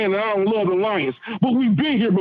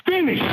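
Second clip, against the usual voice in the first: high, clipped one-word punch; emphatic finish; 8 semitones higher than usual. Real, not acted. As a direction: land it like a chant. Caller repeats emphatic finish.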